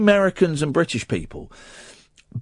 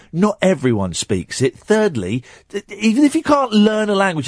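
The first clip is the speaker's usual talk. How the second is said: usual speaking voice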